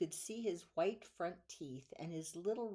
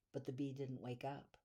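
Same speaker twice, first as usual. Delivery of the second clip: usual speaking voice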